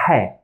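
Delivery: H said between A and H, breathy from the throat; a raspy throat H. Shown as a demonstration. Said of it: "It's not A sound and it's not H sound".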